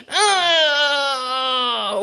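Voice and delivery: long effortful groan; drawn-out strain sliding down; straining grunt. Pretend. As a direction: heave with a pretend push. Acting out the big push.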